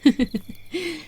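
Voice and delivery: light, teasing giggle; pleased chuckle. Acted. Seductress sure the listener agrees, pleased chuckle. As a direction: laugh knowingly to yourself. Pleased laugh after invitation.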